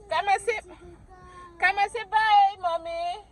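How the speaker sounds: calling upstairs. Real, not acted.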